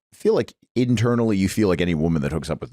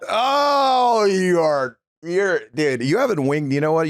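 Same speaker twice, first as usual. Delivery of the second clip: mock outrage; 8 semitones higher, slower than usual. Real, not acted. Outraged at smell answer.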